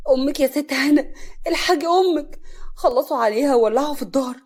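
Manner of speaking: loud, high panicked alarm; fearful. A narrator's reading. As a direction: rush in crying terrible news. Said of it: "دخل شخص بخوف وزهر". (بخوف وفزع)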